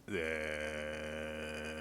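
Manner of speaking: creaky demo; vocal fry. Shown as a demonstration. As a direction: drop into a brief fry. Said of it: "that's where that sound comes from".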